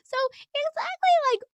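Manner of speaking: very high, loud put-on politeness; nervous squeaky greeting. Acting out nervous greeting to maitre d'.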